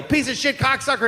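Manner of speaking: high-pitched mock yell; imitated yell. Imitating some guy the boy overheard, yelling. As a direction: holler it like some guy in the street. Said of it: "I heard some guy yell".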